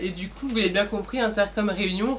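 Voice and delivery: ordinary talk, nothing marked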